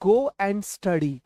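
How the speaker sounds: drawn-out slow delivery; slow model phrase. Shown as a demonstration. Teacher models go and study slowly for students. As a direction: say each word slowly and clearly. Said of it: "तो जाकर पढ़ाई करो".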